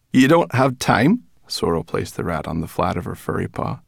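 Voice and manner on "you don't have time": high, loud mocking question; mocking brownie. A narrator's reading. Brownie teasing the captive rat, mocking. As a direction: throw it back loudly, pitched up.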